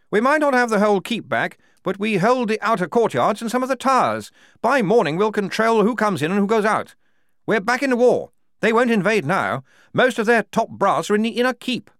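high, loud, bluff officer's voice; booming jolly commander; hearty major. A narrator's reading. The major greeting the squad, loud and bluff.

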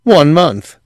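high, loud, grating answer. A narrator's reading. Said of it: "Quayle said gratingly".

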